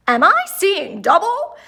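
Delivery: a startled town mayor; surprised mayor. A narrator's reading. Mayor Marva Moose sees two groundhogs, astonished.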